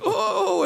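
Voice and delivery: high-pitched mock "oh" of distress; little old lady's cry, imitated; imitated frail cry. Frail lady hanging on the banister, high mimicked cry. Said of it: "she kinda stumbles and she's".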